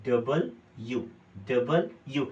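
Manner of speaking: डबल यू as two clear words, double and you; डबल, then a distinct यू; split pronunciation. Shown as a demonstration. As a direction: read डबल यू in separate careful parts. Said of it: "अगर पढ़ते समय देखो".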